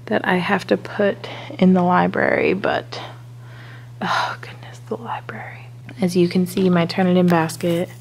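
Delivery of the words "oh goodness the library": hushed, breathless dread whisper. Real, not acted. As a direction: whisper it in mock horror. Dread at library mess.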